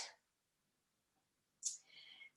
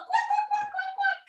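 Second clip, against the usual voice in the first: much higher playful quacking; duck quacks; 5 dB quieter than usual. Pretend. Teacher leading kids as flying ducks, high quacks. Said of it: "ducks and geese go quack".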